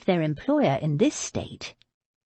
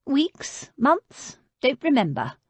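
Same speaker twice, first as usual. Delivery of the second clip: put-on higher, querulous old woman's voice; old lady; 7 semitones higher, slower than usual. Old lady refusing food, voiced higher. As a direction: play her as a frail but stubborn old lady.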